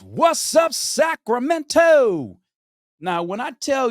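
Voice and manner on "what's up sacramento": high, loud hype-man holler; imitating a booming announcer's shout; arena MC. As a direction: greet the whole arena, stretching the city name. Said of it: "comes out on the microphone and goes".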